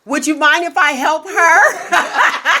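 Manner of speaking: slow, overly sweet request, laughing; mock polite. Asking the patient permission with laughing mock politeness. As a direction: lay the politeness on thick. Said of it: "I said".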